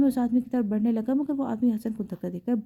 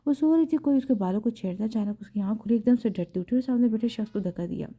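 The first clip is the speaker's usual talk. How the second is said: ordinary delivery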